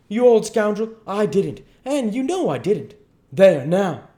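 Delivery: very high, loud protest; outraged denial. Acted. Duke denying it to the king, outraged. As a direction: fling the denial back forcefully. Duke angrily denies the charge.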